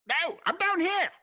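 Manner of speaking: very high, loud little gnome; squeaky gnome voice. Acted. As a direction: shout up from knee height. Gnome character calls up.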